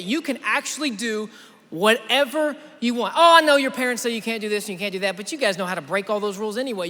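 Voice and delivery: normal talking voice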